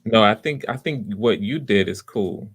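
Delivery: ordinary delivery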